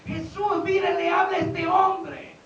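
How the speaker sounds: high, loud, drawn-out proclamation; forceful preaching. Acted. Preacher returning to Jesus speaking to the man, shouted proclamation. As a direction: call it out over the congregation.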